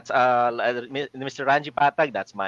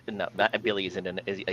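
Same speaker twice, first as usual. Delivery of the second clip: usual speaking voice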